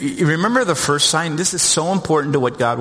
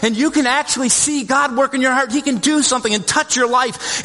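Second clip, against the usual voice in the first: higher pitched, urgent sermon build; fervent preaching; 7 semitones higher than usual. Acted. Pastor warning the congregation about sin, fervent and raised. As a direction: press the warning home to the congregation.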